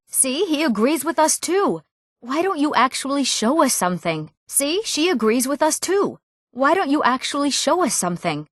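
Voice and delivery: high-pitched, insistent student; eager challenge. Acted. Student pressing the teacher to show magic, insistent. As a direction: push the teacher, pitched well up.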